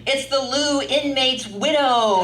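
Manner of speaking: high, drawn-out mock hooting; jeering heckle. Cricket club ridiculing her as she crosses the patio, jeering heckle. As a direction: hoot it like a drinking crowd teasing. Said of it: "man, they dig in".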